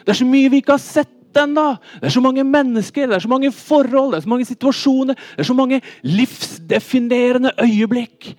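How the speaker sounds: loud, high, rapid-building sermon delivery; full-force preaching. Acted. Preacher urging congregation to follow Jesus, full-force preaching.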